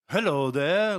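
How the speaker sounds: a hint of a posh British gent; light Harry Potter-style greeting; lightly put-on British voice; British accent. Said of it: "Harry Potter-ish".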